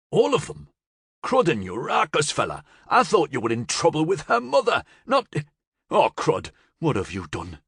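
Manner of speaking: high-pitched, incredulous outburst; alarmed disbelief. A narrator's reading. Joffler realising the convoys are after Florian, alarmed. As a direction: burst out in dismay, then sink at the end.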